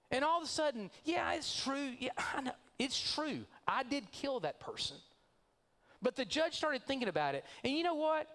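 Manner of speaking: high, casual self-satisfied brag; freed killer bragging to the church folks, smug and high; smug breezy boast. Acted. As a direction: shrug off the murder like it's nothing. Freed killer brags about release.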